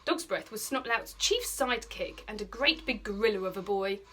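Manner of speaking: ordinary delivery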